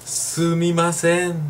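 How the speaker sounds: slow, drawn-out polite sumimasen; a hammed-up apologetic "Sumimasen"; exaggerated Japanese apology. Shown as a demonstration. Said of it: "un poco más de sabor".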